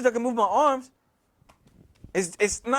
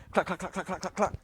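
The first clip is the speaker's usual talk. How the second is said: soft, lower clack-clack patter; rapid clacking noise; 5 semitones lower, 8 dB quieter than usual. Retold or imitated. Something lands and runs across the floor, soft clacking. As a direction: imitate light, even running clatter. Imitates noise running out the house.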